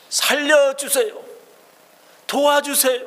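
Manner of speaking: a blind beggar crying out to be saved; desperate crying out. Acted. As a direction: beg for your life at the top of your voice. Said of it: "바락바락 소리 질러대는 것입니다".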